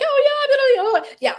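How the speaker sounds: high-pitched mock-enthusiastic yeah; mock eagerness. Speaker mimics her own first reaction, in mock eagerness. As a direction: put on an over-eager, swooping high voice.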